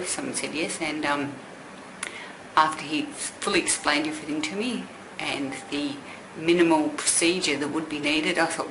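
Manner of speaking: ordinary talk, nothing marked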